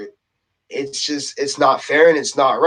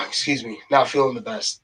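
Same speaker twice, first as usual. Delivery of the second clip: winded, lower and softer aside; out of breath; 4 semitones lower, 5 dB quieter than usual. Real, not acted. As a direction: catch your breath after getting worked up. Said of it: "getting a little bit out of breath".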